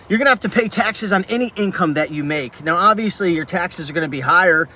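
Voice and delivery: ordinary talk, nothing marked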